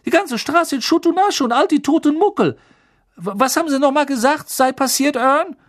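high, loud breathless storyteller; excited gossip. Acted. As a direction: relish every grisly detail. Stan voiced by narrator.

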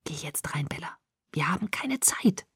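hushed, whispered urging; very soft, urgent near-whisper. A narrator's reading. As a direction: press urgently under your breath. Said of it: "drängte er".

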